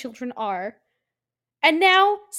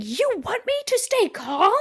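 mock scream; 8 semitones higher than usual. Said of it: "Karen screaming".

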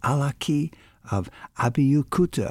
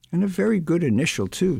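fast, higher, tossed-off joke; quick quip; 6 semitones higher, faster than usual. Acted. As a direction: rattle it off as a sly dig. Joe quips in pub banter.

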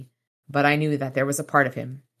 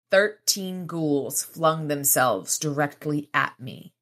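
ordinary talk

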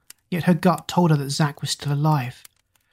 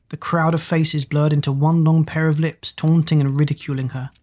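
plain reading voice

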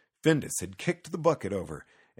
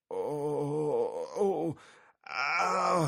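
moaning and groaning, pitched up; crying out in pain; 7 semitones higher, much slower than usual. A narrator's reading. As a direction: wail out each hurt cry, higher. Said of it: "he cried".

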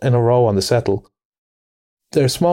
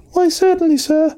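very high, polite little mouse voice; respectful field mouse. A narrator's reading. Field mouse answering the Rat about shops, respectful and piping. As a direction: pipe up deferentially. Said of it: "replied the Field Mouse respectfully".

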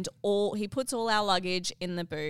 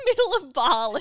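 ordinary delivery

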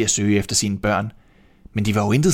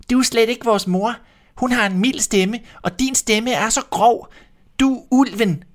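put-on little kids shouting; 12 semitones higher than usual. A narrator's reading. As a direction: squeak it out like small kids refusing. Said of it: "råbte de".

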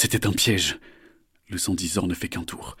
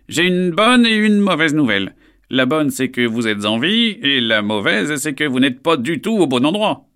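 high, loud bird of prey; proud raptor speaking; stern falcon voice; 7 semitones higher, 9 dB louder than usual. Acted. Alain delivers news.

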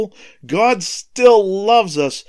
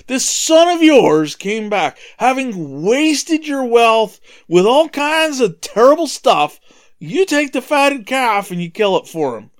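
slow, louder, bitter complaint; resentful son accusing his father; angry older son; 7 semitones higher, 5 dB louder than usual. Acted. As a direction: spit out the charge against the brother. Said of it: "And the son's mad".

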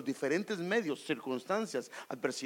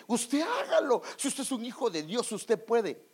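high, cheery mock pep talk; lightly voiced pushy optimist egging him on; mock hype friend; 4 semitones higher than usual. Preacher mimicking people who push you on, high mock cheer.

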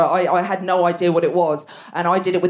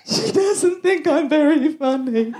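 slow, higher, sobbing; mock crying; 7 semitones higher, slower than usual. Telling her husband through tears, mock sob. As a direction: wail it out self-pityingly.

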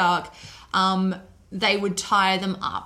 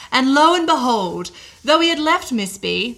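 louder, higher declaimed eyewitness account; reading aloud; 4 semitones higher, 8 dB louder than usual. A narrator's reading. Podcaster reads a séance sitter's account to co-host, declaimed. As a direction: declaim an old account with a flourish.

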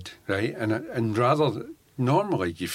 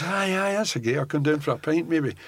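breezy higher casual reply; 6 semitones higher than usual. Retold or imitated. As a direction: toss off a noncommittal yes. [name] answering the band invite.

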